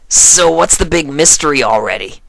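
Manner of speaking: impatient and bold; Rainbow Dash. A narrator's reading. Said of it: "Rainbow Dash said".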